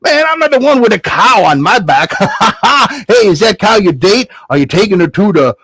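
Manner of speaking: high teasing voice with laughs; joking stranger cracking up; mocking dude laughing. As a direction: laugh at the boy and his cow. Stranger mocks Jack.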